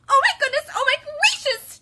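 very high, loud panicked chick; Chicken Little. A narrator's reading. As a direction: gasp it in frantic chicken squeals. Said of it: "Chicken Little panted".

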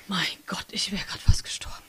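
breathless whispered relief; relieved whisper. Acted. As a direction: whisper it as the tension drains away. Relief after boss leaves. (Henry flüstert erleichtert nach dem Schreck)

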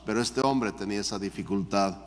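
ordinary talk

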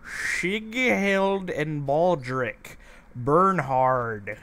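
"Sighild and Baldric Bernhard" read out very slowly, syllable by syllable; careful name reading. Real, not acted. Reading villager names aloud.

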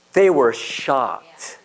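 drawn out very slowly; very slow emphasis. Real, not acted. Preacher stressing the crowd's surprise, very slow. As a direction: stretch every word.

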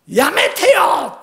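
high loud "stop it" yell; angry shout. Retold or imitated. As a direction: snap it out as a shout. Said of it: "怒鳴るわけ".

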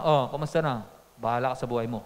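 low, curt brush-off, lightly acted; understated cold-shoulder greeting; displeased wife. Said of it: "may, may komosyon".

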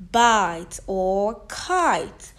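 slow repeat of the demo; slow stretched words. Shown as a demonstration. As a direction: bite or kite said very slowly. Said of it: "as in".

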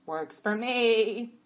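loud, very drawn-out donkey voice; offhand donkey. A narrator's reading. Jack butting in on the jenny, slow and loud. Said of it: "Abbott said".

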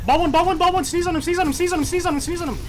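high, rapid-fire repeated pleading; urgent callouts. Real, not acted. Gamer urging team to finish ball, urgent high calls. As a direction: urge the team on, over and over.

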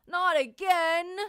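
loud, slow, high exasperated holler. Acted. Tank falling into the pond, slow loud holler. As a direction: holler it out slowly. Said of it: "Tank hollered".

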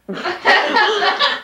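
high, bright laugh breaking out; burst of laughter. Real, not acted. Reader laughs at own story.

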